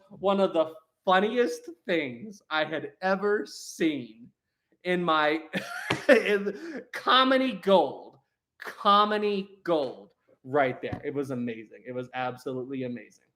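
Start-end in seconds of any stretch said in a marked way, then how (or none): 6.1-10.1 "comedy gold" stretched and spaced out; weighty, drawn-out emphasis; slow emphatic praise. Real, not acted. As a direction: savour each word of "comedy gold".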